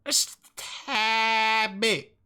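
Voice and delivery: "just a tad bit" stretched very slowly; drawn-out repeat. Real, not acted. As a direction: drag out "tad bit" pleadingly. Stretching the phrase.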